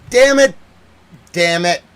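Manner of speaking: loud, high frustrated curse; angry cursing. Real, not acted. Cursing the BBC's coverage, loud and angry. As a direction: spit it out in frustration.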